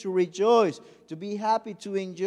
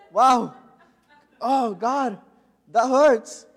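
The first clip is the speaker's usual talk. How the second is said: 1 mock pained outcry; 4 semitones higher, 10 dB louder, much slower than usual. Retold painful fall.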